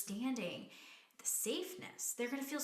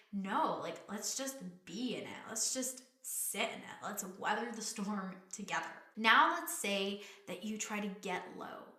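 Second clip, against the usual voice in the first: ordinary talk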